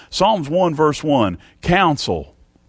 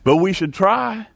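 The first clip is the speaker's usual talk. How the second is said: "try" pitched up and pressed, then falling; stress on try; 4 semitones higher than usual. Shown as a demonstration. Preacher pressing the congregation to try, stress on "try". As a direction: press hard on "try" at the end. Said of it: "Put the emphasis on that".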